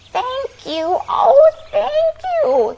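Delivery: high, rising thankful cry; grateful cry. Acted. Witch thanking her rescuers, high grateful cry. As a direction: pour out your thanks. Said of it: "the grateful witch cried".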